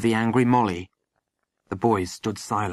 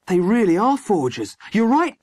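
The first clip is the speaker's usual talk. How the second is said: put-on high-pitched, louder boyish thrill; excited; 10 semitones higher, 4 dB louder than usual. A narrator's reading. Boy telling his friend he was right, excited. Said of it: "said Midge excitedly".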